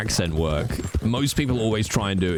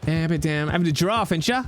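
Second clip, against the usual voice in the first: higher-pitched threatening Cockney banter; 7 semitones higher than usual. Pretend. Cockney warning someone off a gold bar, mock threatening. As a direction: play a mouthy Cockney squaring up. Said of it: "Can you hear my Cockney?".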